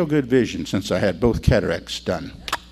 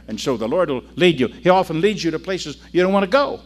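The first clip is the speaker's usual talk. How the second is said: ordinary delivery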